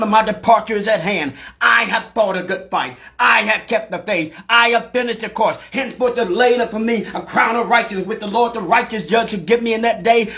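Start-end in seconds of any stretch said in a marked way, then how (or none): none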